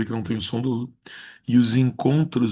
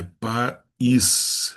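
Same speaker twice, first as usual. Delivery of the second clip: país split in two, with the Í held apart; stress on Í. Shown as a demonstration. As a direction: separate "país" before the stressed ís. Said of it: "como o i é forte".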